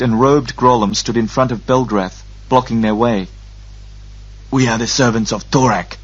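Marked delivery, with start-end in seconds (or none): 4.5-6.0 put-on guttural Murgo speech; old man imitating Murgo gutturals; guttural Murgo accent. A narrator's reading. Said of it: "an accent that perfectly matched the gutturals of Murgo's speech".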